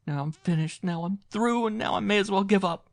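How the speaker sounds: very high, loud wailing sobbing speech; hysterical lament. A narrator's reading. Washed-up actress wailing over her lost comeback, hysterical. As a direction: wail it out between heaving breaths. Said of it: "she heaved with ricocheting breath".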